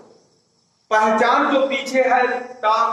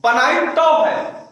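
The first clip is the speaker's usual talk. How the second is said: higher, slow, तो stressed in annoyance; mock angry; 6 semitones higher, 3 dB louder, slower than usual. Teacher imitating an irritated daughter, mock angry retort. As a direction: snap back "बनाई तो है" leaning on तो. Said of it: "गुस्से में है तो कैसे कहती हैं".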